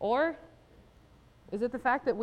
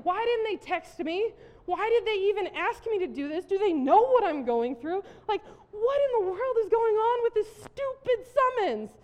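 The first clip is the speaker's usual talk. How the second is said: frustrated rant; 9 semitones higher, 5 dB louder than usual. Retold or imitated. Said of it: "And I'm thinking".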